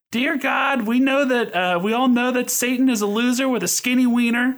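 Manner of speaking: imitating a child saying grace; praying first grader. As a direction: pray like an innocent small child. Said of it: "as a first grader".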